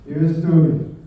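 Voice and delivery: toast. Real, not acted. Closing toast to late dad.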